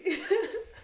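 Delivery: short high laugh. Real, not acted. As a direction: burst out laughing. Laughing at the confusing memory.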